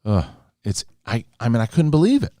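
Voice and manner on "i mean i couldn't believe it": exasperated disbelief. Real, not acted. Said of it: "exasperated disbelief".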